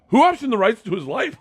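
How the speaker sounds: high-pitched mock bafflement; incredulous question. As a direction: imitate someone utterly baffled. Retold baffled celebrity's question.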